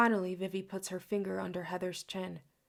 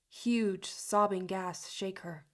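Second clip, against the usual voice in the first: usual speaking voice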